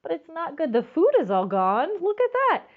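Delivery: ordinary delivery, nothing marked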